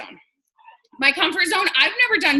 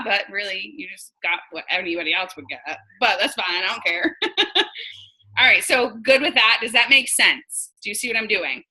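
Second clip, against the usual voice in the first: ordinary delivery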